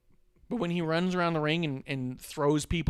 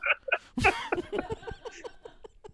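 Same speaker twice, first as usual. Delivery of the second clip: shrieking high laugh; real squeal of laughter. Real, not acted. Laughing after his own bit.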